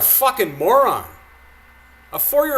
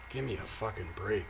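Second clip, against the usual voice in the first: ordinary delivery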